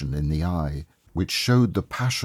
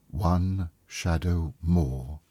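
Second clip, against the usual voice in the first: ordinary delivery, nothing marked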